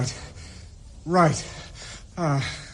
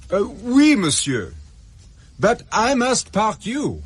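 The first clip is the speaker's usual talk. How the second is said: higher, slow put-on French accent as a car park attendant; 8 semitones higher, slower than usual. Adam poses as a French car park attendant to an Englishman, in a put-on French accent. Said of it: "Stupid Englishman thinks I'm the attendant".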